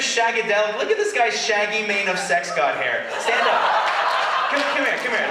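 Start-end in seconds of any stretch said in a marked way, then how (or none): 3.2-5.2 a call across the room, higher. Real, not acted. Performer calls the long-haired fan up, shouting. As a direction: call him up to the stage. Calling audience member up.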